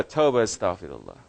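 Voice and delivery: normal talking voice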